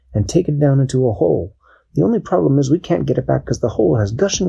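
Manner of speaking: Captain Barnacle. Acted. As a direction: rattle off the problem like a keen captain.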